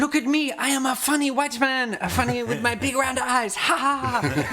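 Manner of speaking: high, mock-accented jeering voice; mocking Chinese voice. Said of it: "Chinese people are over there just being like".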